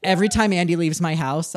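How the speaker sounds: normal talking voice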